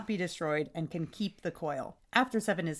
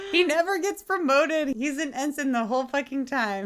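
loud, high-pitched indignation; loud, incredulous rant; exasperated outburst; 9 semitones higher, 6 dB louder than usual. Real, not acted. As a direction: burst out in comic outrage, easing slightly. Outburst over character never promoted.

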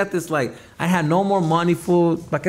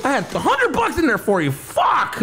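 high-pitched, aggrieved retold outburst; upset; 10 semitones higher than usual. Customer telling dancer about his lost money, upset. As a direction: wail the complaint, pitched way up.

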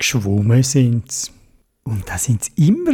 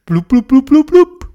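bubbling sounds; 9 semitones higher, 5 dB louder than usual. A narrator's reading. Bubbles rising underwater.

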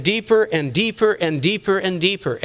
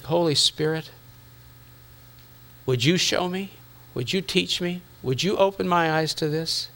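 soft, humble asking prayer; quiet plea to God; 2 semitones lower, 6 dB quieter than usual. Retold or imitated. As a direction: ask gently like a seeking prayer. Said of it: "And I said".

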